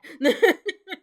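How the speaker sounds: loud high cackle; big, high laugh. Real, not acted. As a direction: burst out laughing. Laughs at the accent.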